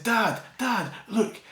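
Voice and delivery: put-on high eager little-boy voice; excited child. Son showing his dad bath toys, high eager child voice. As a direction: imitate a child wanting Dad to look. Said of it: "and he said".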